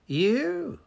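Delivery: ordinary delivery, nothing marked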